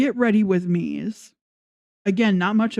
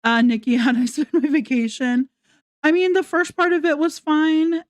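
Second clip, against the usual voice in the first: mock vlogger; 7 semitones higher than usual. Mocking Nikki's vlog voice.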